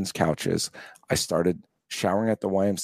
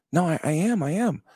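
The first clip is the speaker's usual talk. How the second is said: high protest; 10 semitones higher than usual. Retold or imitated. Protesting to his buddy.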